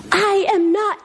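high-pitched cartoon superhero holding firm; mock-heroic refusal; Larry Boy. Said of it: "this is Larry Boy Plungers".

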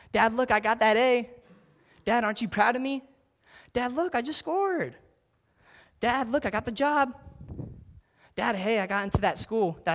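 put-on high, loud kid seeking praise; eager child. Child showing off good news to his father, high and eager. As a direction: shout up to dad like a proud kid.

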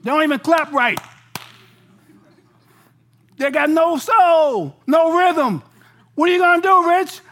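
my body shouting no at this weird church; body's loud protest. Retold or imitated. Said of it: "My body said".

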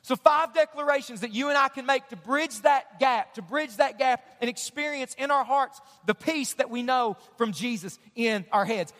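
ordinary talk

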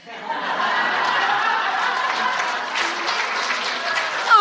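breathy burst of laughter; loud unvoiced laugh. Real, not acted. Storyteller laughing at her own punchline, loud. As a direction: laugh hard at the joke.

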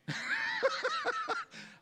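short real burst of laughter; hearty laugh. Real, not acted. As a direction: laugh out freely. Laughs about not watching news.

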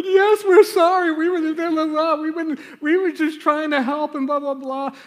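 put-on very high, loud blubbering kid's apology; mock wailing. Caught kids apologizing to teacher, hammed-up high-pitched wail. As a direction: imitate bawling children pleading their case.

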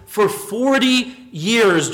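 slow, weighty "forty"; slow emphasis. Real, not acted. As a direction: draw out "For forty years" to let it sink in. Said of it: "slow emphasis on forty".

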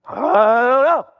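slow, dazed shrug of a voice; a lost man with no answers; clueless man. Acted.

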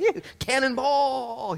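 high, loud, drawn-out whoop; gleeful yell. Acted. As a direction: yell it like jumping into a pool. Imagined gleeful leap into heaven.

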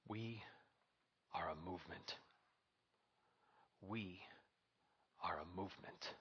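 soft, quiet, with long pauses; hushed. Acted. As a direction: hold it low and intimate. Quiet build before "say it with me.

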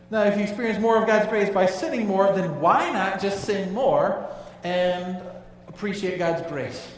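rising sermon intensity; preaching at full force. Real, not acted. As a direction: press the point hard to the congregation. Preacher building to a point.

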